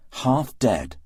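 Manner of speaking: plain reading voice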